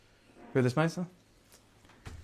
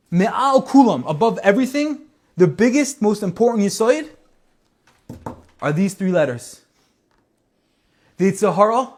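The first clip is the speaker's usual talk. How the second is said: higher and louder, driving each phrase home; fiery preaching; 6 semitones higher, 5 dB louder than usual. Acted. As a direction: preach it at full force to the crowd. Preaching climax of the story.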